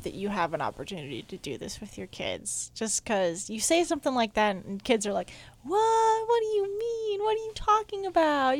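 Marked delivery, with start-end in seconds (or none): 5.6-8.6 put-on high, whiny kids' disbelief; mock kid disbelief. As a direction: whine it like a baffled kid.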